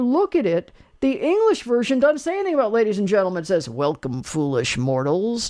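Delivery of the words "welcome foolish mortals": dark lord. Retold or imitated. Haunted Mansion greeting quoted.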